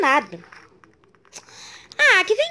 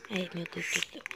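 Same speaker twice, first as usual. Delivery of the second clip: normal talking voice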